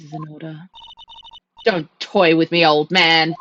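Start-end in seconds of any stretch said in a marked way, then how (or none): 1.7-3.3 put-on furious yell at the old man; angry shout. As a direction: bark it out in rage. Defendant snaps at attorney.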